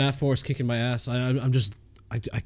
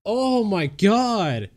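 real shaken shock; 7 semitones higher than usual. Real, not acted. Player reeling from a jump scare in game three, shaken. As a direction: stretch the oaths out high, reeling from a scare.

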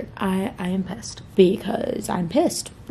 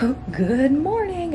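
high, slow perky good morning; cheerful body voice; 7 semitones higher, slower than usual. Retold or imitated. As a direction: chirp it like a wide-awake body. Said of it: "my body was like".

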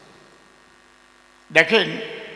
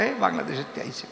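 mock dismissive remark; 5 semitones lower, 9 dB quieter, much faster than usual. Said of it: "কলকাতায় গিয়ে বলবেন".

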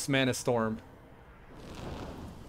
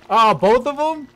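high, loud surprised cry; startled yelp; 9 semitones higher, 10 dB louder than usual. Real, not acted. Player startled by a choice, loud high yelp. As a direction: yelp as if caught off guard.

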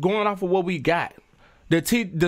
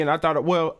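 usual speaking voice

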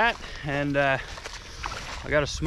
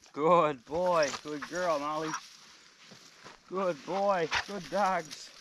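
squeaky praising a dog; 7 dB quieter, slower than usual. Real, not acted. Praising dogs softly.

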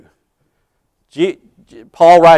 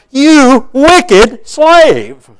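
much higher, louder, very slow rebuke; stern condemnation; 9 semitones higher, 5 dB louder, much slower than usual. Acted. Lord condemning his wicked slave, slow thundering rebuke. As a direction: drag each word out in judgment.

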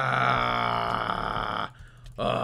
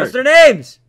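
loud impatient yell; shouting; 12 semitones higher, 20 dB louder, slower than usual. Real, not acted. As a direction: yell the question again. Yelling repeated question.

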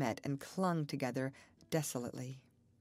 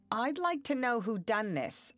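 stern, raised demand, higher and louder; 7 semitones higher, 5 dB louder than usual. A narrator's reading. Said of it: "looking sternly".

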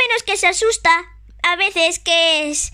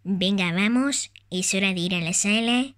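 mother toy voice; 8 semitones lower than usual. Pretend. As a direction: play the gentle mother of Tag. Plays Tag's mother.

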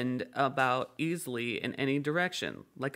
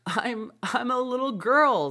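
doubtful; 10 semitones higher, 6 dB louder than usual. A narrator's reading. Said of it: "rather doubtfully".

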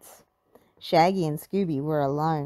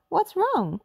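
high worried call; Daphne crying out; 11 semitones higher than usual. A narrator's reading. Daphne asking the frightened cook, high and urgent. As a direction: rush to ask what happened. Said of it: "cried Daphne".

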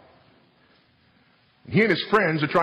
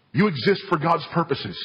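ordinary delivery

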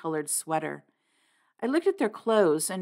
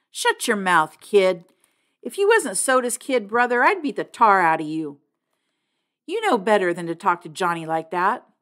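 louder, furious scolding; angry; 5 dB louder than usual. Acted. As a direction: threaten him, hard and loud. Two-Bit scolding after a slap.

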